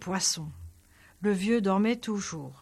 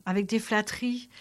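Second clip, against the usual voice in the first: plain reading voice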